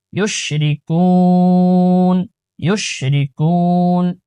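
slow, drawn-out Quran recitation, each vowel stretched. Shown as a demonstration. Teacher recites the verse slowly to students, melodic chant.

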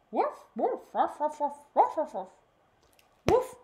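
loud high puppy woofs; loud dog barks. Acted. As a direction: bark joyfully like a puppy. Puppy barking while romping.

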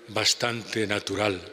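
ordinary talk

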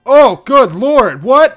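loud, high, slow disbelief; shocked. Real, not acted. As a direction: gasp it out in shock. Shocked at glitching game.

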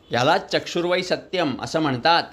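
ordinary delivery

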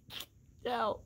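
"Kicked out" squealed through real laughter; laughing disbelief. Real, not acted.

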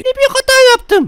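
very high, loud falsetto cry; shouting cat. Pretend. Babysitter voicing the story's cat, hammed-up panicked shriek. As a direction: wail it as a panicked cartoon cat. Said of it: "diye bağırdı kedi".

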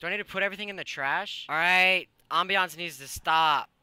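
usual speaking voice

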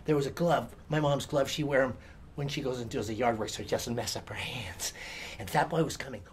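ordinary delivery, nothing marked

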